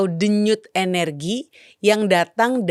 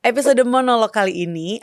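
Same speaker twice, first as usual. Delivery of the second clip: podcast greeting; 5 semitones higher, 5 dB louder than usual. Acted. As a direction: greet listeners warmly like a show host.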